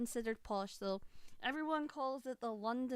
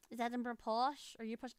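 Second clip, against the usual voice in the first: ordinary talk, nothing marked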